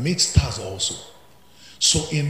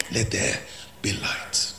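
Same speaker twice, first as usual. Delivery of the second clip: whispered, softer; hushed proclamation; 4 semitones lower, 5 dB quieter, slower than usual. Acted. Preacher whispering the verse to the congregation, hushed. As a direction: breathe it out as a whisper.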